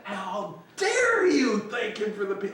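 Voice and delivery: high, stretched-out indignation; hammed-up imitation of an irate boss; mock outraged Ralph. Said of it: "legendary stories about Ralph's yelling".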